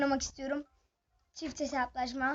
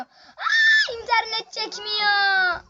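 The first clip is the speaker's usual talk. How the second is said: high, loud and slow, dragging out the words; frustrated shout; 8 semitones higher, 8 dB louder, much slower than usual. Real, not acted. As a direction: yell it at the lagging game. Lag in the match, shouted repeat. (bağırarak, uzatarak isyan)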